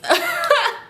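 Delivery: shrill rueful laugh; high burst of laughter. Real, not acted. Mocking her past belief they'd marry: high rueful laugh. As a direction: laugh at your own naivety.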